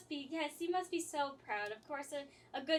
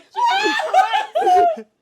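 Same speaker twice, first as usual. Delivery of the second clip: high, loud laughing shout; stunned outburst; 21 dB louder than usual. Acted. Player stunned by the fox boy, shouting in disbelief. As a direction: blurt it out in shock. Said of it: "I just stare at him like".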